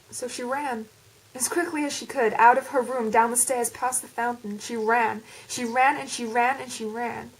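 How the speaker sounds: English speaker attempting an American accent. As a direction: read the story in a put-on American accent. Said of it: "That's my attempt at an American accent".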